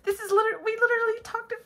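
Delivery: high-pitched, eager rush; can barely contain the thrill; excited. Real, not acted. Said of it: "I can't help but be excited".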